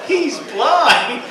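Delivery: punchline laughing. Acted. Punchline voiced for the head.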